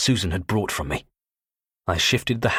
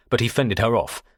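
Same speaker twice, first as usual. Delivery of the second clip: usual speaking voice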